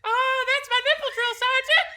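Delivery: very high, loud startled recruit voice; squeaky private. Retold or imitated. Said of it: "Private goes".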